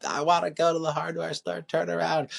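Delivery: high-pitched mock tantrum plea; whining little kid. Dad imitates his son in the car, whining little kid. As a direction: imitate a child wailing to go back.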